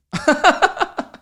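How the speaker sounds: sudden high laugh at his own joke; high burst of laughter. Real, not acted. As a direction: crack up high and loud. Laughs after harsh joke.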